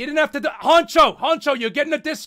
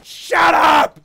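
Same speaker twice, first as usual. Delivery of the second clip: high, loud outburst; mock angry yell; 4 semitones higher, 5 dB louder than usual. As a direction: shout it down sharply. Yells back while playing.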